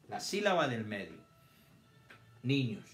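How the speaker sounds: normal talking voice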